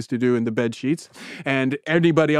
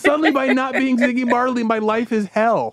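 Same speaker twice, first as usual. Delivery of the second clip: exasperated comic lament; 8 semitones higher than usual. Real, not acted.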